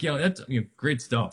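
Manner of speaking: usual speaking voice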